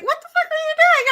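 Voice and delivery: high-pitched, baffled demand; mock-incredulous outburst; incredulous brother. Acts out brother's baffled reaction.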